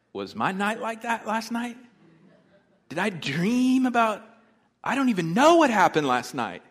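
high, loud mock bewilderment; baffled disbelief. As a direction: act out incredulous questioning, voice rising loud. Acting own baffled reaction.

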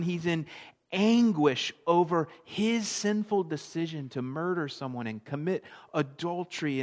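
ordinary talk

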